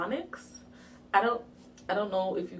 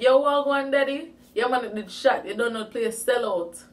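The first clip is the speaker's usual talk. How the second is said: loud, higher-pitched Patois greeting; Jamaican Patois; 5 semitones higher, 8 dB louder than usual. Retold or imitated. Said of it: "my dialect and come to him correct".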